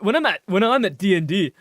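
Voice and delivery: normal talking voice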